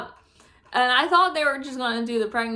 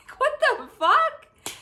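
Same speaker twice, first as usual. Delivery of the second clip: disbelief; 13 semitones higher, slower than usual. Real, not acted. Disbelieving laugh.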